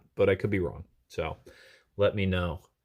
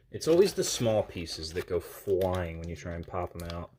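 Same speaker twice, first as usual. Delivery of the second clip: normal talking voice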